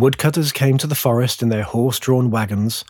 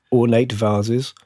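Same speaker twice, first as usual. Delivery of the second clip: ordinary delivery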